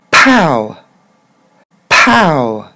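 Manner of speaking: "Pow" with a burst of air before ow; plosive English P. Shown as a demonstration. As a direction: punch "Pow" loudly, aspirated P, long falling ow. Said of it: "il y a comme une mini-explosion".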